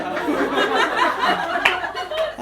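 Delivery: squealing high laughter. Real, not acted. Laughing hard.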